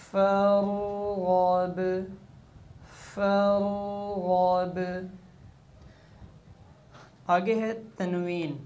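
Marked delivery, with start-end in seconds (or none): 0.0-5.1 फरगब drawn out, ending on stopped b; waqf form. Shown as a demonstration. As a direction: recite फरगब slowly and stop. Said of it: "ऐसे ही पढ़ी जाएगी".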